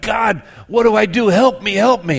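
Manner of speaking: loud, high plea for help; mild hammed-up desperate call; crying out. A narrator's reading. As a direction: shout up to heaven from the cliff. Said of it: "he's crying out to God".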